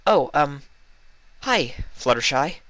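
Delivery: high, caught-out greeting; a lightly voiced startled unicorn; Twilight. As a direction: sound caught red-handed.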